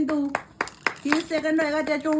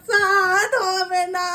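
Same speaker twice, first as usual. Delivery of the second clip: slow stretched count; drawn-out call; 3 semitones higher, 6 dB louder, much slower than usual. Real, not acted. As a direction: draw out the last number. Final seconds of the contest.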